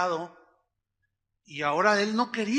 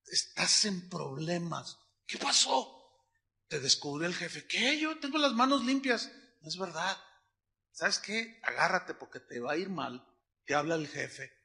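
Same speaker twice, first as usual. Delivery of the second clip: hushed gossip; 3 semitones lower, 8 dB quieter than usual. Retold or imitated. Office gossip between coworkers.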